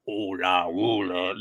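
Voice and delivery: low-pitched alien creature voice; Jabba the Hutt. Retold or imitated. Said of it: "where we got Jabba the Hutt".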